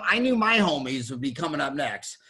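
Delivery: usual speaking voice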